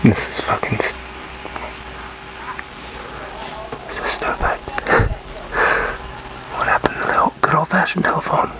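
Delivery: whispered frustration at the phone; fed up, whispering to himself about technology; muttered whisper. Real, not acted. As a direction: grumble it under your breath, annoyed. Fumbling with the call setup.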